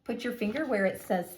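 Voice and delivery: ordinary talk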